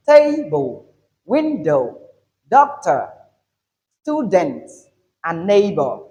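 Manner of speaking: "table, window, doctor, student, neighbor", each punched on its first syllable; first-syllable stress. Shown as a demonstration. Teacher reads example nouns, first syllables of "table" to "neighbor" stressed. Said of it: "the position of the first syllable".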